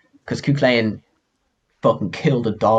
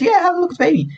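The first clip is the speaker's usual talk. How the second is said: much higher, hammed-up wife's voice; 3 dB louder, much faster than usual. Fionn's wife showing the giant the baby, high voice. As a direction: squeak it out like the sly wife.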